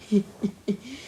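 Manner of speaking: laughing at the silly face; short laugh. Real, not acted. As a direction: giggle at the joke.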